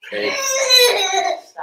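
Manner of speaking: high laughing squeal on it's; burst of laughter. Real, not acted. Talking to viewers, a high burst of laughter. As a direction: break up laughing.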